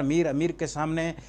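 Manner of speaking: ordinary talk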